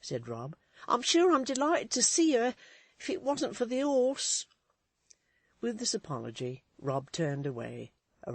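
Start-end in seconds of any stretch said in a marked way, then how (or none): 0.9-4.5 higher, louder nervous lad's voice; a jumpy errand boy making excuses; young servant boy pitched up high; Rob the Grinder. Acted.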